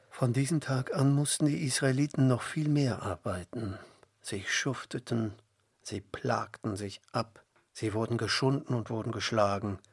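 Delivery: ordinary delivery, nothing marked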